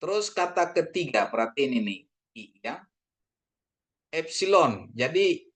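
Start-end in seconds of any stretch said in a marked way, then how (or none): none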